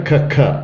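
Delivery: short repeated hard k sounds. Shown as a demonstration. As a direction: isolate the k sound, twice. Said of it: "a hard C".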